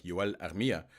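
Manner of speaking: Yoel Armia with a hint of a Finnish accent; understated Finnish accent on the name; light Finnish accent. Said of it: "I don't know why I added that little accent".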